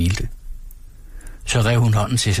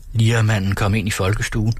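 plain reading voice

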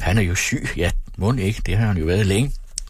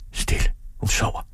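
hushed, voiceless whisper. A narrator's reading. Father hushes over the sleeping mother, whispering. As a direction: whisper so as not to wake her. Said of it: "hviskede han".

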